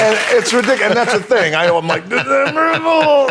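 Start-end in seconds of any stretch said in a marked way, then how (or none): 2.1-3.3 very high, slow sustained yell; drawn-out prank cry. Shown as a demonstration. Showing how the prank reveal must be said, high drawn-out cry. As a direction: sing out FOOL high and long. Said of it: "you gotta say it like that".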